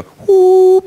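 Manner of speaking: high, loud sustained hoot; a sudden clarinet note, done by voice; imitated clarinet toot. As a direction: toot out one piercing note. Imitating clarinet finally sounding.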